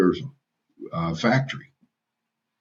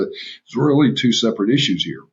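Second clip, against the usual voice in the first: normal talking voice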